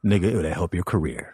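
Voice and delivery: low, fast, offhand mumble; lazy Paul voice. Retold or imitated. Paul invites the comic on tour, low and offhand. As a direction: toss it off like a casual offer. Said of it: "He goes".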